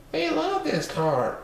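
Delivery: put-on high, slow kids' cry; children shouting. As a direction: call it out like small children. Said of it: "shouted the five little Popsnuggles".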